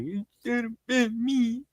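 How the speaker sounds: high, soft, slow mock wail; hushed, high imitation of a lament; mock small lament. Mimicking someone echoing the line, soft high lament. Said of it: "they were gonna be like".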